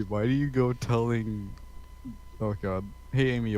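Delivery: usual speaking voice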